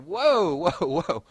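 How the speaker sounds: startled, laughing yelp; loud surprised whoa. Real, not acted. Reacting to chat names.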